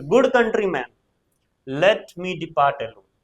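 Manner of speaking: loud then slow Brutus line; slow recitation. Shown as a demonstration. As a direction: declaim it slowly. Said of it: "Brutus बोल रहा है".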